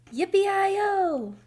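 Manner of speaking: high, loud whoop; cowboy holler. A narrator's reading. Cowboy's holler heard outside again.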